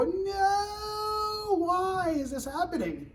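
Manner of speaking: long, high wailed "no"; mock despairing wail, drawn out and sliding down; exasperated security professional. Security professional reacting to controls being dropped, exasperated wail. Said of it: "as a secu-, cybersecurity professional, you go".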